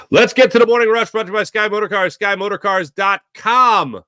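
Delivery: upbeat radio sponsor read; announcer voice for the sponsor. Acted.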